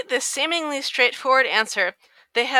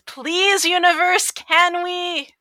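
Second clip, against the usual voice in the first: higher, pleading whine; 7 semitones higher, 4 dB louder, much slower than usual. Real, not acted. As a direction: beg the universe, half joking. Pleading to universe.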